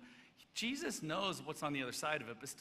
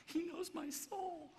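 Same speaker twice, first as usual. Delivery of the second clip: high, slow, drawn-out squeal; mock creeped-out; 12 semitones higher, much slower than usual. Preacher joking about uncomfortable eye contact, high creeped-out voice. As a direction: stretch it out in a high, spooked little voice. Uneasy under a long stare.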